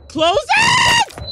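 high frantic yell; shrill panicked command; panicked shout. Real, not acted. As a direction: scream the order in panic. Panicked at door.

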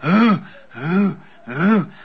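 low moans imitating haunted women; lightly imitated ghostly moaning; mournful moaning. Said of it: "they were going".

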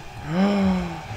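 high, soft, long gasp; delighted gasp. Real, not acted. Gamer spotting a tiny door, delighted gasp. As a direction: gasp in awe.

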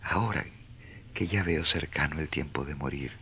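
hushed prayer. Acted. Francis prays alone at dawn.

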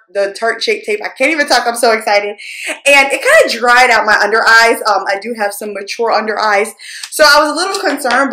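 usual speaking voice

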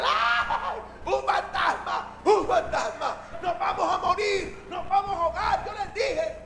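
high, frantic mock screams; panicked screaming. Disciples spot a ghost on the water, hammed-up panicked screaming. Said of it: "Y entonces gritan".

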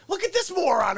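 yelling at masked drivers from the car; mocking yell. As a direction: yell at the idiot drivers, sneering. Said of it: "I'm yelling at people".

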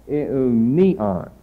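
Eunion with the middle -ni- accented, ending falling away; stress on penult. Shown as a demonstration. Teacher showing the feminine form, stress on penult. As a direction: lean on the next-to-last syllable of Eunion. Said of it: "an acute accent on the penult syllable".

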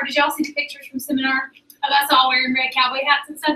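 normal talking voice

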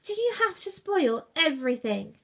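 shouted. A narrator's reading. Brutus annoyed at interrupted game, mild shout. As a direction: protest with some exasperation. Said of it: "shouted Brutus".

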